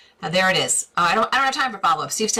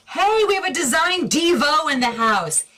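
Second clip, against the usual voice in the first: high, whooping welcome; excited cheer; 10 semitones higher, 3 dB louder than usual. Real, not acted. Host greets the guy joining the questions, excited cheer. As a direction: cheer it out, pitched way up.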